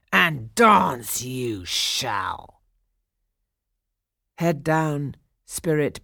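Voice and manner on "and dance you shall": very slow, menacing growl. A narrator's reading. Pirate chief ordering his captive, slow growl. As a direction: draw the threat out in a growl. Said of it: "he growled".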